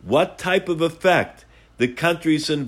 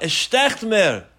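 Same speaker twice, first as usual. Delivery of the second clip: pained cry in Yiddish, drawn out slowly; anguished cry; 5 semitones higher than usual. Retold or imitated. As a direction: cry out as if it stings. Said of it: "screamed out".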